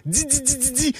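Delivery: high, soft, rapid string of dzi; Quebec z after D. Shown as a demonstration. Explaining the Quebec rule, di voiced high as dzi. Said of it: "on ajoute un Z".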